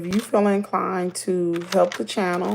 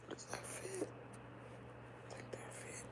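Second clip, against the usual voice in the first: whisper; 11 semitones higher, 27 dB quieter than usual. Real, not acted. Muttered aside while fitting something.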